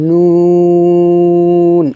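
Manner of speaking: long "নুন" with fully rounded lips. Shown as a demonstration. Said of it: "সম্পূর্ণ ঠোঁট গোল করে উচ্চারণ করব". (ঠোঁট গোল করে লম্বা "নুন")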